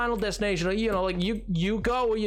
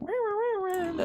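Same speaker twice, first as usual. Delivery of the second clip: high mock wail of a whining kid; 13 semitones higher, slower than usual. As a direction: imitate a child whining to a parent. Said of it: "kept being like".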